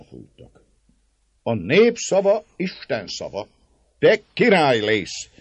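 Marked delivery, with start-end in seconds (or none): 1.4-5.3 fervent. A narrator's reading. Márton urges György on.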